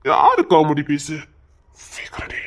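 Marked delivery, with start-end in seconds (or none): 1.9-2.4 breathy whisper, no voice at all; urgent whisper; whispered hurry. Acted. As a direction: hiss it low so no one hears. Hushed aside to wife.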